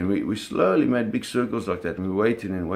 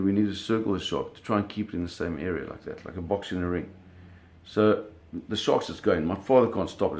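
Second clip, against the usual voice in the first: normal talking voice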